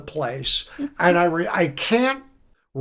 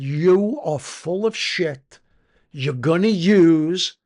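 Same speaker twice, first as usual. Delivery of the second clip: slow, firm, a bit louder sponsor's scolding; stern retold rebuke; 3 semitones higher, 3 dB louder, slower than usual. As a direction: lay it out slowly like a tough sponsor calling his bluff. Sponsor confronts him on phone.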